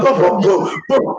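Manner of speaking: punchy repeated booms; imitated cleaning racket. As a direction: mimic someone banging about as they clean. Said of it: "make so much noise".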